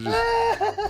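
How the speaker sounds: laughing high and slow. Real, not acted. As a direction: laugh through the words. Imitating blacked-out face.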